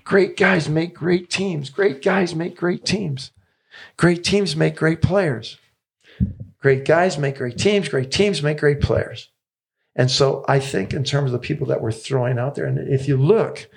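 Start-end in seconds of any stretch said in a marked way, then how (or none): 0.0-9.3 slow, higher, each great leaned on; repeated mantra. Retold or imitated. Coach quoting his friend's motto, slow higher chant. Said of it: "He says".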